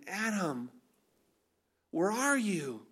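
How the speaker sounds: softly calling the name, then a pause; tender, different tone. Shown as a demonstration. Preacher shows God seeking hiding Adam, soft and tender. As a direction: call gently for someone who is hiding. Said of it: "Completely different tone".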